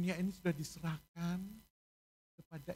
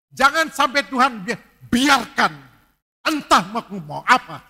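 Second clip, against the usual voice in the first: preaching at full force; 16 dB louder than usual. Acted. Pastor pleading with the church to repent, loud preaching. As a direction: cry out the call to repent.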